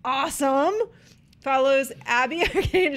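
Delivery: excited. Acted. Hyped announcement.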